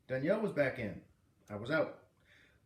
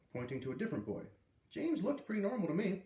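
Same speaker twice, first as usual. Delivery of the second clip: plain reading voice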